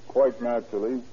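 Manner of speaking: ordinary talk, nothing marked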